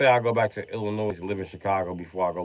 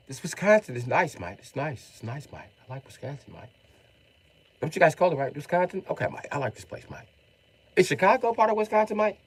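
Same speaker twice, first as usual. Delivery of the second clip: higher-voiced imitation London bloke; London accent; 5 semitones higher, 4 dB quieter than usual. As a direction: chat approvingly as a London visitor. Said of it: "guys from London have told me".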